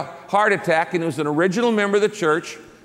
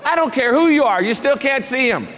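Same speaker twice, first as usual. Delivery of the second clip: high, loud mock of a stern nurse refusing; 7 semitones higher, 3 dB louder than usual. Nurse blocking the pastor at intensive care, mock stern refusal. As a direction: play the nurse snapping back.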